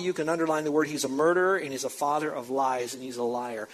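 normal talking voice

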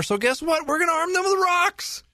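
very high, incredulous punchline; hammed-up absurd announcement; mock excitement. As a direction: climb into a squeaky, sarcastic reveal. Mocking a superintendent's silly plan.

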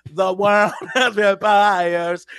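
very slow, dragged-out and droning. Retold or imitated. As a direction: stretch every word like a gloomy rock singer. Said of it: "he rolls in like".

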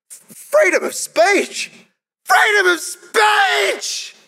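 very high, slow yelled slogan; hammed-up cry of freedom of speech; mock protest shout. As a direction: bellow it like a ranting protester.

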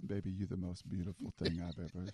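low, very soft lover's murmur; hammed-up hushed romantic gushing; mock sweet talk. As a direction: murmur it low like a smitten husband. Mimicking the gushing love message.

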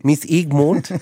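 mocking New Zealand accent. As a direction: mimic the teacher's New Zealand vowels. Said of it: "relentlessly mocking her, her accent".

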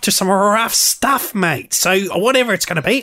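high-pitched, hammed-up Aussie cowboy accent; Tarantino's Australian accent. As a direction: parody a bad film attempt at rough Australian talk. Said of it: "the greatest Australian accent".

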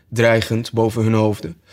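ordinary delivery

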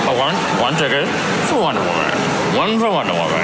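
ordering a ticket out of the corner of the mouth; side-mouth talk. Shown as a demonstration. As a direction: keep the lips half shut and mumble sideways. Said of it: "talking out the side of my mouth".

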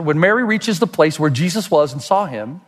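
usual speaking voice

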